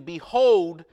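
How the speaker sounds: loud voice from the throne; loud proclamation. Acted. Reading out the voice from the throne, loud proclamation. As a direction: proclaim it with force. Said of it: "I heard a loud voice".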